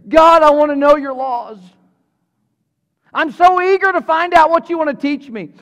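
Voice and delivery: high, loud, bursting with eagerness; loud, high, eager morning prayer. Pretend. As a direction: pray like you can't wait. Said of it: "woke up and said".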